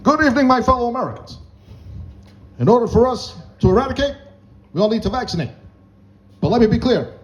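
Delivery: high-pitched, measured presidential oratory with deliberate pauses; Obama. Acted. President urging Americans to vaccinate, as Obama. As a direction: address the nation in short, spaced phrases. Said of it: "Obama would have been something like this".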